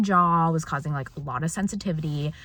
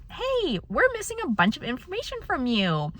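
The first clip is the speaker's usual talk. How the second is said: quoting receptionist; 10 semitones higher than usual. Retold or imitated. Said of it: "they're like".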